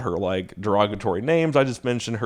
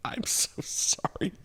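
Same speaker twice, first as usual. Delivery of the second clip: sheepish whisper, high; whispered apology; 10 semitones higher, 10 dB quieter than usual. Retold or imitated. Comedian whispering sorry to the company president. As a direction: whisper it like a scolded kid.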